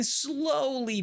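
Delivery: slow, elongated narration; drawn-out slowly. A narrator's reading. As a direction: stretch "slowly" right out. Stretches the word to mimic slowness.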